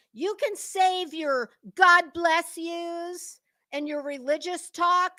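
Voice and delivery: normal talking voice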